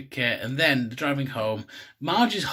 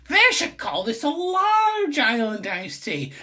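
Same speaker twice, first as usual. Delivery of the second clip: high, strained cartoon wife shriek; Marge Simpson; 12 semitones higher, 4 dB louder than usual. Marge drinks it and comments, high strained cartoon voice. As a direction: push it up into a squawking put-on voice. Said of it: "she drinks it and like".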